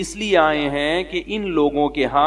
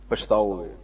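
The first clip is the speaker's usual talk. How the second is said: soft repeated warning; 7 semitones lower, 7 dB quieter than usual. Retold or imitated. Cleric repeating the warning to the father, quietly fading. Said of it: "میں نے کہا".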